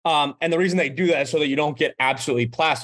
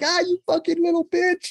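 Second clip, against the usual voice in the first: much higher, playful retold jeer; mock German taunt; 12 semitones higher than usual. German drinkers jeering at their tricked friend, high mock jeer. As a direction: mimic the German buddies ribbing him. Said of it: "his buddies were like".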